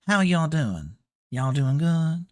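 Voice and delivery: folksy Texan drawl; friendly Texan twang, casual; Texan accent. Shown as a demonstration. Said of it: "That would be this".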